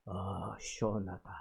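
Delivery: low, soft, breathed-out "시원하다" falling away; quiet, low relieved murmur with a falling end; hushed sigh of relief. Retold or imitated. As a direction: sigh "아, 시원하다" softly, like relief under the blows. Pastor beaten, quoted sighing relief.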